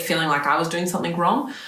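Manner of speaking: usual speaking voice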